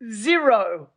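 "zero" set off by a pause, loud and falling; stress on zero. Real, not acted. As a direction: land hard on "zero". Hammering home a comic point.

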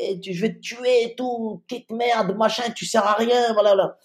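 lightly voiced Ivorian threat; Ivorian accent. Drunk guard threatening the injured man, light Ivorian accent. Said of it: "avec son accent ivoirien".

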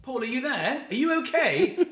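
high-pitched calling to someone out of sight; retold calling out. Comedian acts calling to friend in the woods, high worried call.

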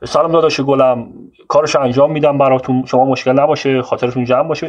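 loud, boastful mock reassurance; imitating Shaban. Narrator mimics boastful Shaban promising to handle the case, loud and hammed-up. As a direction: ham up a showy braggart promising everything. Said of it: "اگه بخوام مثل خودش صحبت کنم".

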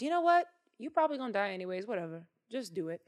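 dismissive shrug. Acted. Saul giving up on David, fast dismissive shrug. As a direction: wave him off. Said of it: "Saul goes".

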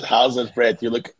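ordinary talk, nothing marked

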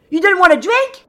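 high, loud boat-style holler; hollered question. Acted. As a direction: shout the question across the deck. Hollering offer again to surprised captain.

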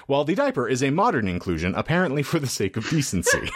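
giggling through the line; laughing. Real, not acted. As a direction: read while cracking up. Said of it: "laughing through the read".

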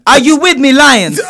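high, loud mock battle call to the lions; rally the lions like a hammed-up warrior; mock rallying cry. Host mocks Nala's rallying call to the lions with a high, loud cry. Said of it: "Lions attack".

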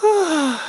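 high-pitched sleepy yawn; big yawn. Acted. Tired little fox before bed, big yawn. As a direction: yawn wide and audibly. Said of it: "Elle bâille un grand coup".

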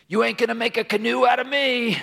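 high, strained defiant holler; defiant Alabama guy. Acted. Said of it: "the guy from Alabama".

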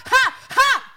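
loud, high, sarcastic "ha ha ha"; big fake laugh at a foolish hope; scornful, punched-out laughing; mock laughter. Mocks her own earlier prediction.

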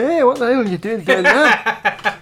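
calling Scots. Retold or imitated. Scottish people shouting down to the last survivors, slow laughing call. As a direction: hail them from far up north, slow and laughing. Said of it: "the Scottish people go".

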